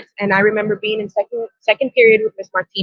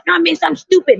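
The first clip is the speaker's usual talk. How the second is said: higher-pitched retold kid's protest; lightly voiced student; 7 semitones higher than usual. Student protesting to the teacher, lightly imitated in higher pitch.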